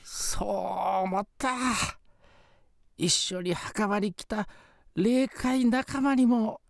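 put-on slow, high old ghost; quavering grateful spirit grandpa.

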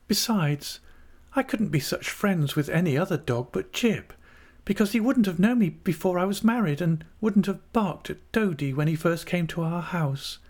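high, earnest character voice; young wife Dora. A narrator's reading. Dora refusing another dog to her aunt, earnest.

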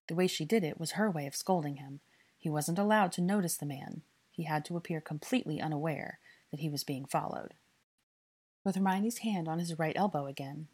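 usual speaking voice

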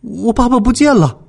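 put-on high-pitched, worried child's cry; urgent, pitched well up; anxious. A narrator's reading. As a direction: panic that Dad has vanished. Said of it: "着急地说".

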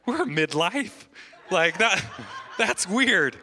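high, loud laughing quote; joking about being midlife, laughing. Retold or imitated. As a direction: laugh through the words. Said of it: "We're like".

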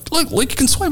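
high mocking imitation of a friend. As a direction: squeak it out like a smug friend. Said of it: "He goes".

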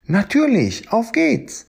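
very high, bouncy agreement; a high happy yes, off we go; cheerful Stegosaurus. Acted. Lily agrees to help Fred in a very high cheerful voice.

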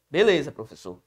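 lightly voiced student, BELEZA falling low; student's reply. Pupil answers the teacher, lightly acted falling reply.

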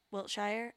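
Wiltshire said "WILT-shyer", questioning; trying a pronunciation. Shown as a demonstration. Host wonders about the county's name, Wiltshire as "WILT-shyer". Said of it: "I don't think it's Wiltshire".

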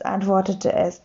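usual speaking voice

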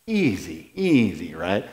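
very low, soft soothing; calming. Acted. Preacher talking himself down from panic, soothing. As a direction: hush the panic down.

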